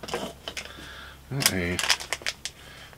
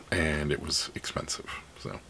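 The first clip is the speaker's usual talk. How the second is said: whispered aside; 4 semitones lower, 12 dB quieter than usual. Real, not acted. Stage aside about thrift prices.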